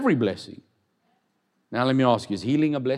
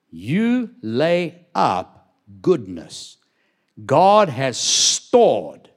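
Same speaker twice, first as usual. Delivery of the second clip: slow emphasis; 5 dB louder, much slower than usual. Acted.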